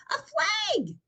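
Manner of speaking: thrilled at seeing the flag; excited exclaim. A narrator's reading. Franklin thrilled by the flag, exclaims in excitement. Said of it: "exclaimed Franklin".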